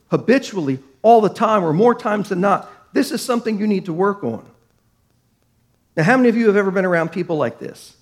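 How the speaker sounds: usual speaking voice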